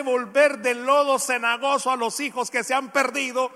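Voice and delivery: fervent pulpit questioning; rhetorical preaching. Real, not acted. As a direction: challenge the congregation question by question.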